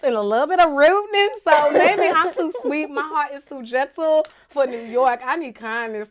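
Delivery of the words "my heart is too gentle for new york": ordinary talk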